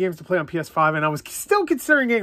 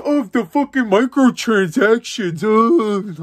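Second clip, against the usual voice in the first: mock whiner; 6 semitones higher, 4 dB louder, much slower than usual. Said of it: "they're like".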